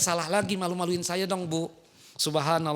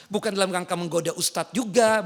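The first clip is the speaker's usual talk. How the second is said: ordinary talk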